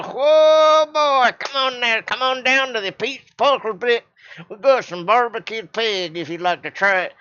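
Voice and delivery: a booming country preacher's Southern welcome; over-the-top Southern barbecue host; Southern drawl. Acted. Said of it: "use a little bit of Southern drawl now".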